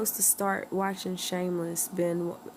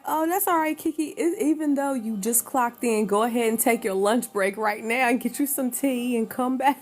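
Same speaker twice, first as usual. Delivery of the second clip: higher voice, lightly acted reassurance; imitated easygoing coworker; 4 semitones higher than usual. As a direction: lightly mimic a coworker waving them off. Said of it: "They gonna be like".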